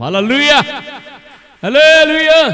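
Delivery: full-force rallying cry; praise shout. Real, not acted. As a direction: call it out, then bigger and longer. Repeated call, second bigger.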